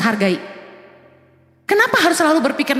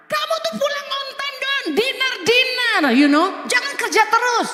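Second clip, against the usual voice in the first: high, soft pleading whine; whiny wife; 8 semitones higher, 3 dB quieter than usual. Retold or imitated. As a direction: coax the husband home, spoilt and clingy. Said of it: "Marah-marah". (suara istri manja merengek, tinggi dan pelan)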